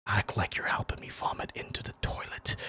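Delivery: breathy conspiratorial whisper, barely audible. Retold or imitated. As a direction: whisper the plan so no one hears. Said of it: "And I just whisper".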